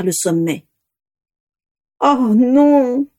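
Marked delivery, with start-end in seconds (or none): 2.0-3.1 higher, anguished moan; a hurt little groan of despair. A narrator's reading. Vera watches her dragons fly away, moaning. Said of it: "gémit Vera".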